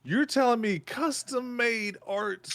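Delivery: high, slow, amazed disbelief; you can't believe it's real. Real, not acted. Amazed at the stretch goal.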